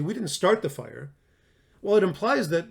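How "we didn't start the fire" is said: "start" punched above the other words; stress on START. Shown as a demonstration. Teacher asking what the words imply, stress on "start". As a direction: lean on "start" as the key word. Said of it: "What does it imply when you say".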